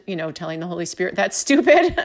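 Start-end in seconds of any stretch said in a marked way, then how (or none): 1.1-2.0 higher, louder mock retort; mock dismissive. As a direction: blurt it out in amused disbelief. Retelling her retort to the Spirit.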